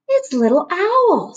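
high, slow, wide-eyed cub voice; a small cub pointing, delighted; little bear voice. A narrator's reading.

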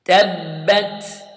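تبت slow, clear t sound; recited Tabbat. Shown as a demonstration. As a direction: say تبت with a crisp ta. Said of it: "I want you to repeat after me".

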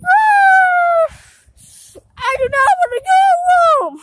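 high wailing shout against going home; crying scream. Real, not acted. Child refusing to leave the park, crying scream. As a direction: scream and wail in protest. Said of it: "You do not talk to me like that".

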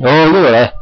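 startled and pleased; surprised yelp. Real, not acted. Light suddenly comes back.

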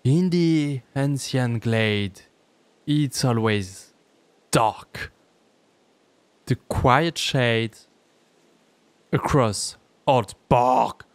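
very slow, drawn-out English verse recital; poem recitation. Acted. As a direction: recite the English lines slowly, with long pauses. (récitation de poème, très lente)

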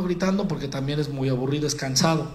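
ordinary delivery

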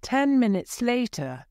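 usual speaking voice

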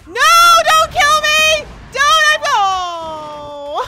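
very high, loud shrieking; panicked scream. Real, not acted. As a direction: scream and plead as the attack hits, ending in a wail. Attacked in game, pleading for life.

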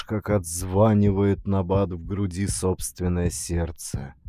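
ordinary delivery, nothing marked

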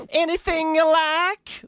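high, drawn-out flirty-service catchphrase; Cindy. Retold or imitated. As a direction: stretch it out in a high put-on character voice. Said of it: "That's the line from".

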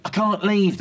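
high, loud flustered doctor; urgent refusal. Acted. Doctor busy delivering a baby, flustered and loud. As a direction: call it out mid-delivery, pressed.

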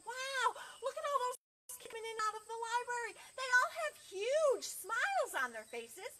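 high, loud wondering animal chatter; excited animals. Acted. Animals marvel to each other about the library, excited. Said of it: "looked at each other and said".

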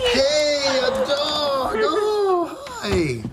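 high-pitched thrilled cry at a dog; delighted squeal. Acted. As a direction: squeal with joy at the puppy.

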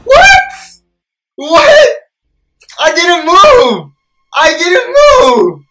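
very high, loud wail of protest; shocked screaming. Real, not acted. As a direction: shriek it in disbelief, stretching the words. Protests right after jump scare.

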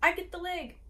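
high, eager call-out; boy's shout. A narrator's reading. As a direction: pipe up high to claim it first. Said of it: "Federico shouted".

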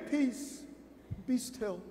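soft gentle quote. Retold or imitated. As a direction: say it tenderly, barely there. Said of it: "didn't say".

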